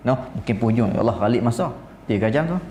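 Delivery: usual speaking voice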